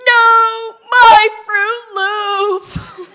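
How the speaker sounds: loud, dragged-out despairing cry; anguished wail. Acted. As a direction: wail over the lost treasure. Dog eats his treasure.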